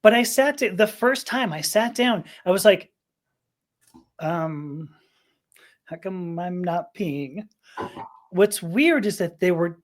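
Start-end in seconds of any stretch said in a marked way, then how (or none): 4.2-7.5 softly puzzled, slow and halting; slow, quiet bafflement at oneself. Retold or imitated. To oneself, the first time sitting down, puzzled. Acting out confusion on first try.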